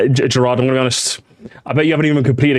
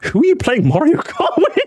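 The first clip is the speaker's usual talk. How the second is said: incredulous laughing; 13 semitones higher than usual. Retold or imitated. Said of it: "but I'm just like".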